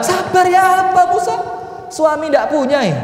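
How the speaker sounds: widow's high keening plea; wailing widow. Acted. Widow wails at Musa.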